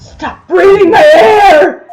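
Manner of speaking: loud, high, slow shout breaking into a cry. Acted. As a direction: scream it out, sobbing and desperate. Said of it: "There was a shout".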